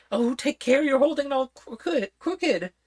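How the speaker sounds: put-on high, fussy old queen. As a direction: talk up high like a flustered queen.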